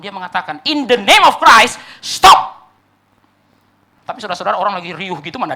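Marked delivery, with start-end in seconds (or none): 0.7-2.7 loud, slow, raised cry to halt everything; imitated shout. Monk crying out inside the stadium, imitated full shout. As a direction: shout it slowly over a stadium crowd. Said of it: "dia teriak di dalam stadion".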